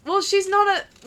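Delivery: high, loud mimicked protest; retold loud objection. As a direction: imitate a boy objecting loudly, slowly. Acting out a boy's objection.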